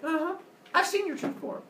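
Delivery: high, sassy retort; acted high-pitched scorn; scornful taunt. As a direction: throw it back at him, unimpressed. Scornful woman taunts Zeus.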